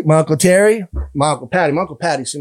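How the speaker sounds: ordinary talk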